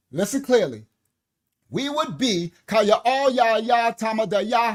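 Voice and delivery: loud fervent preaching; rally the congregation, full voice. Acted. Preacher exhorting the children of Yah, loud.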